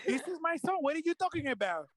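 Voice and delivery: lightly imitating a mom's Spanish accent, upset. Mother snapping at doubters about her son, in a Spanish accent. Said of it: "with her Spanish accent".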